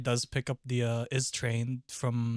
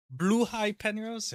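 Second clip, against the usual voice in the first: slow, high-pitched query; raised-pitch exclaiming question; high surprised question; 10 semitones higher, 4 dB louder, slower than usual. Real, not acted. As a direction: ask it high and drawn out. Excited question, then calm answer.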